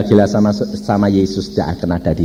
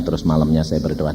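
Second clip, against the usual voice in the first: ordinary delivery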